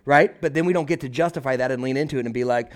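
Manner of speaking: ordinary talk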